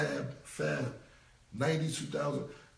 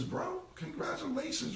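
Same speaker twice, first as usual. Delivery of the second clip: mock congratulations. Mocking the other rapper's story.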